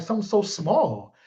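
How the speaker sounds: ordinary talk, nothing marked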